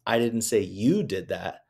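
you lifted and punched; weight on you, the rest even; stress on YOU. Shown as a demonstration. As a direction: hit you as if it was someone else. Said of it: "but said four different ways".

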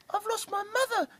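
high squeaky chick voice; cheeping chick. Acted. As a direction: peep it like a lost chick. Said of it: "cheeped little chick".

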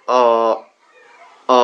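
অ held long and pressed, like a stretched schwa. Shown as a demonstration. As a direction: say অ long and pressed back in the throat. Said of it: "একটু চাপা হবে".

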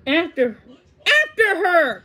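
urgent command building to a shout; loud, high battle order. A narrator's reading. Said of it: "commanded Mister Mole".

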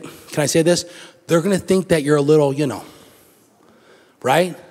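ordinary talk

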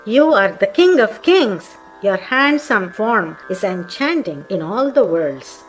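usual speaking voice

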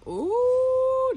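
loud, high excited ooh; a real burst of delight; loud high ooh at a find. Real, not acted. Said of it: "Uh, I'm loud".

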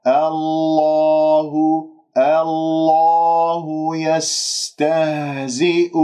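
slow chanted الله with dark l and relaxed lips; unrounded heavy lam. Shown as a demonstration. Teacher models correct tafkhim after the mirror advice, slow sustained chant. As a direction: sustain الله, lips still, then stretch يستهزئ. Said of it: "انظر إلى المرآة أمامك".

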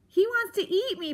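high, small timid voice; faint frightened mouse. A narrator's reading. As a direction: squeak it out like a scared little mouse. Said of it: "Poppy said faintly".

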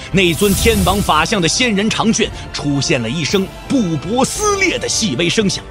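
ordinary delivery

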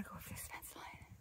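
hushed fast whisper. Real, not acted. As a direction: whisper it under your breath. Hiker whispering on the trail.

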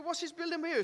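high, bright-eyed questioning rescuer voice; curious rescuer. Retold or imitated. Rescuer quizzing the stranded man, high and curious. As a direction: play the nosy rescuer asking. Castaway joke, rescuer asks.

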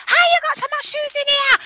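high-pitched mock Jamaican scolding; imitated crazy mother. Mimicking a mother berating her children, mock mad. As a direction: mimic a mother going crazy over clutter. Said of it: "She was going crazy".

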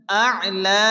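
আ'লা with the লা held one beat; lengthened la. Shown as a demonstration. Teacher showing students how to lengthen the final la. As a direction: stretch the লা of আ'লা for one alif. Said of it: "শেষে লা এক আলিফ টেনে পড়তে হবে".

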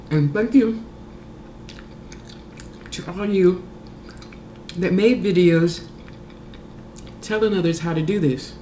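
chewing between words; talking while eating. Real, not acted. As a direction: mouth partly full. Seen 2: Holds crawfish, not taco; bites between words at 6.9–10.9s.

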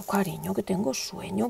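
soft, drowsy reply to her son; tired mum murmurs "I'm sleepy"; sleepy mother. Retold or imitated. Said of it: "y yo".

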